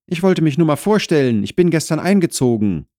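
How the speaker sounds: polite newcomer talking briskly; Wolfgang explaining himself. Acted. New tenant to neighbour at the door, quick polite explanation.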